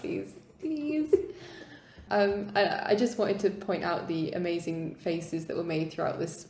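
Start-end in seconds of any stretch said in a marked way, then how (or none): 0.0-1.1 mock begging, the second one higher; playful pleading. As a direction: plead playfully, rising on the repeat. Begging for more ship's cats.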